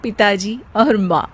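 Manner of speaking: plain reading voice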